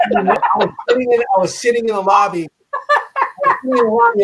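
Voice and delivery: high, laughing retelling; laughing as he tells it; laughing while telling. Real, not acted. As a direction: giggle through the words.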